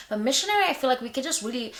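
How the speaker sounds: ordinary delivery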